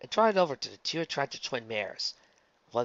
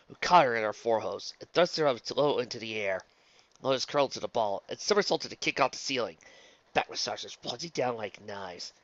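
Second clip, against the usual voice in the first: ordinary delivery, nothing marked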